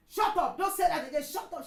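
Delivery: loud high shout of shut up. Retold or imitated. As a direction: yell it to silence her. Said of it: "She shouted".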